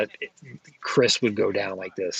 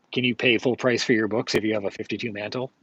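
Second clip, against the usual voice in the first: ordinary talk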